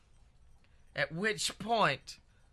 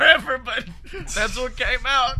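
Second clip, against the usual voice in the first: laughing at himself; 6 semitones higher, 7 dB louder than usual. Real, not acted. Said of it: "I've never done a Gilbert Godfrey impression before".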